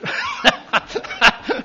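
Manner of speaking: a sudden high burst of laughter. Real, not acted. Laughing at his own airline story. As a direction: crack up at the punchline.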